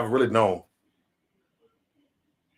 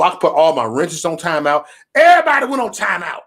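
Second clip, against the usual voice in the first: usual speaking voice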